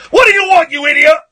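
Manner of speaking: high, loud, angry demo shout; shouty shock jock. Shown as a demonstration. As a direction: demonstrate yelling at an idiot caller. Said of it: "do you prefer it when I do this".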